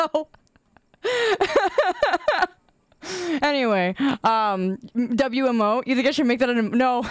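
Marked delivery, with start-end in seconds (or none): 1.0-2.5 high-pitched burst of laughter; laughing high. Real, not acted. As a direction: crack up in a high giggling run. Laughs at her own excuses.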